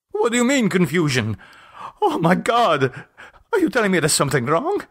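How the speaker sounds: high, fast, frightened questions; frightened questioning. Acted. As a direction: rush the words out in rising fear. Jose fears a wrong result.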